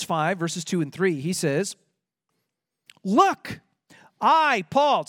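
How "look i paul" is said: raised, forceful quoting of an apostle; insistent preacher quoting a solemn warning; emphatic. Retold or imitated. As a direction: punch each word higher to drive the warning home. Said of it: "he's being so emphatic".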